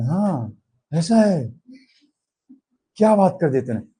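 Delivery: ध्यान से voice rising in astonishment; surprised. Shown as a demonstration. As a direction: sound truly taken aback. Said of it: "ये voice है, लेकिन voice में change आया".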